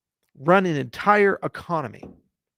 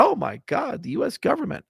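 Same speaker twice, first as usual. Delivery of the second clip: "Oh my God" pitched much higher in mock delight; mock excitement; 9 semitones higher than usual. Mocking his past self's hope in government, high mock delight. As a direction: lampoon your own past hope. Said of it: "I got excited about it".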